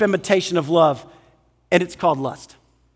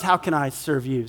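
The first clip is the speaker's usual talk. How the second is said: low, hushed, humble question; gentle offer to serve; 7 semitones lower, 7 dB quieter than usual. Acted. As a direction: drop low and soft, offering help. Love's selfless question.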